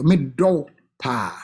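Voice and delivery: put-on slow, tender love to the child. Parent telling child I love you, slow and tender.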